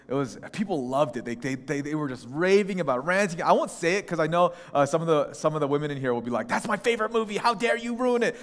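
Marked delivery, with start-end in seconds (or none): 6.5-8.4 higher, lightly voiced protest; mock outrage of offended movie fans; indignant fans. Women in audience imagined objecting.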